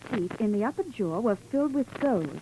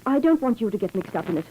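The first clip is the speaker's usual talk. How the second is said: ordinary talk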